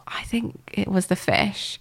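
real whisper, slowed right down; whispered, drawn-out admission; hushed confession. Real, not acted. Confessing a childhood cartoon crush.

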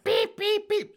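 high loud beeping like a bleep tone; mock censor beep. Host bleeping the old woman's slurs, a high loud beep. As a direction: beep out the slur in a shrill tone.

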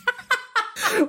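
real high cackle of delight; telling the proposal story, breaking into laughter; high burst of laughter. Real, not acted. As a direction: laugh out at the memory.

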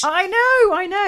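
high, loud squeal of agreement; exasperated agreement. Real, not acted. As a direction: jump in with delighted disbelief. Keen agreement with co-host's mockery.

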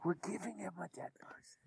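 hushed whisper. Real, not acted. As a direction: breathe it out secretly. Whispered aside in creepy plan.

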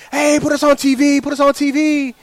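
high, loud call to a passing camera; excited yell. Retold or imitated. High school kids to the Channel Eleven camera guy, yelling. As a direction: imitate kids yelling for attention. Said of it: "you're yelling at him like".

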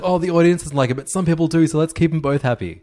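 high, loud mock exec voice; mock a panicking executive; mocking studio exec. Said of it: "it's like, no, it's like".

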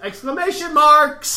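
sudden loud burst on the words; mock shout. Reading listener mail aloud, mock shout. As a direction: yell it out like a punchline.

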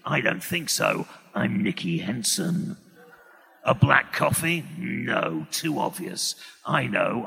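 very low, slow, gravelly seventies actor; Nicky Henson. Acted. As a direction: muse to yourself in a deep, drawn-out gravel voice. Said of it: "talk to myself as Nicky Henson".

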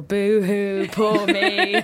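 self-pitying mock whine, laughing; hammed-up pity for yourself. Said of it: "I'm just being dramatic".

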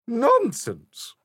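high, loud grandiose queen voice; haughty Queen. A narrator's reading. Queen boasts to Alice about her reign, grandiose. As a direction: proclaim like a self-satisfied monarch. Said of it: "said the Queen".

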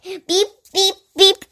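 high robotic beeps; alien beeping. Acted. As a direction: beep like a little alien. Pink alien speaks.

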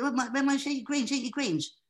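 high-pitched voiceover line; performing the nappy script. Retold or imitated. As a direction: squeak out the baby's advert line. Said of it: "And so I do".

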